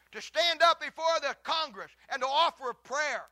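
loud, high, impassioned sermon delivery; full-force preaching. Acted. Pastor preaching hard to the congregation, loud and fervent. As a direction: thunder it from the pulpit.

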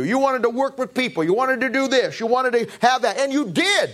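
high, fervent preaching build. Acted. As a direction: plead with the congregation from the pulpit. Preacher pleading with congregation.

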